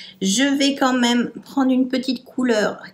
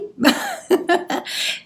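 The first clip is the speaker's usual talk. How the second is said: short laugh; 8 semitones higher than usual. Real, not acted. Laughs at own tutorial remark.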